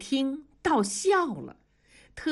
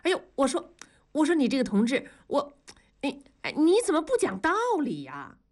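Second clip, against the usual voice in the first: flustered protest; 7 semitones higher, faster than usual. A narrator's reading. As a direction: voice shooting up high in agitation. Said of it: "同志就急了". (着急地拔高嗓门抗议)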